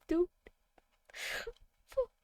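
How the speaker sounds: normal talking voice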